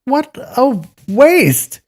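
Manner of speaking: very high, loud, slow outraged cry; swearing. Acted. Inn boy raging at the wasted bun, outraged cry. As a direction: howl it in outrage. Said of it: "swore the inn boy".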